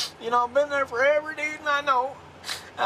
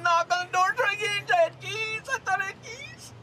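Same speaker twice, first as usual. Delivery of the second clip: high, softer voice breaking into tears; crying; 7 semitones higher, 3 dB quieter than usual. Real, not acted. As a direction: sob out the protest of innocence. Detained man breaks down crying.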